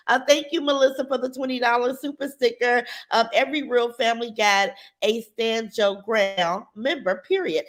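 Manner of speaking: ordinary talk, nothing marked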